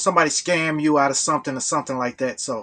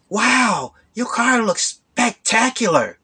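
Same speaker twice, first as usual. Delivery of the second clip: higher and slowed, over-the-top praise; butter him up like a con man; imitating the gushing stranger; 6 semitones higher, slower than usual. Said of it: "people didn't talk like that".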